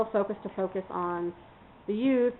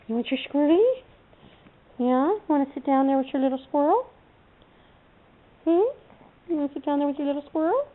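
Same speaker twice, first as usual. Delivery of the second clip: coaxing pet talk; 5 semitones higher than usual. Real, not acted. Coaxing the dog with a toy.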